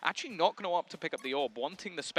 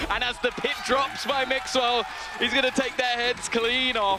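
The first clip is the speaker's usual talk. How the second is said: rising caster hype, louder; excited play-by-play; 8 semitones higher, 6 dB louder than usual. Real, not acted. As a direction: build to the big call. Caster calls the pit drop.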